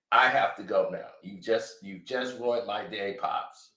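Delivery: fading dejected goodbye; mild hurt son. Retold or imitated. Drake hurt, leaving his father.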